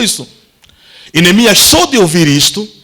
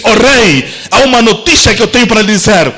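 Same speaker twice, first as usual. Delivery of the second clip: high, shouted declaration; passionate proclamation; 5 semitones higher than usual. Acted. Nehemiah proclaiming to the king, high shout. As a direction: preach it as Nehemiah, full voice.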